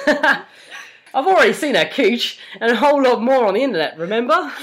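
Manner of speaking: hammed-up Australian accent for a character; broad, unconvincing Australian; dubious Aussie accent. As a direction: play the line in a shaky attempt at Aussie. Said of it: "Very dubious Aussie accent there".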